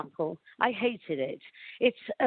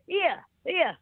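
higher-pitched Welsh "Here, here"; Welsh accent; 8 semitones higher than usual. Shown as a demonstration. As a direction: say "Here, here" as in Wales, like "yeah". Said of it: "from Wales".

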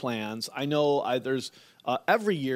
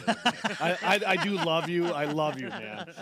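laughing. Real, not acted. Teasing cohost while laughing.

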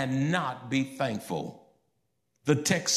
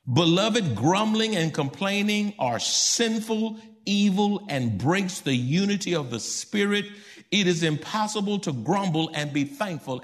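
forceful preaching; 4 semitones higher than usual. Acted. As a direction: press it home to the church.

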